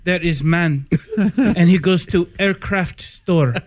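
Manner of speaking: put-on deliberate, accented broken English. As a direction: joke told in a foreign accent. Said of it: "in broken English".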